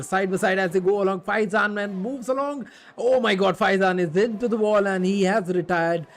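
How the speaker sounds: rising to a loud, high shout at the crash; excited shout. Real, not acted. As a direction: shout it out as the car hits the wall. Crash during live race commentary.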